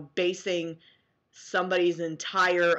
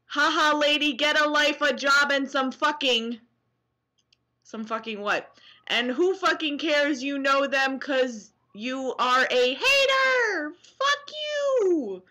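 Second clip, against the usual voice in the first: snarky hater; 6 semitones higher than usual. Acted. Reading a hater's comment to viewers in a shrill mock voice. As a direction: ham up the troll's insults.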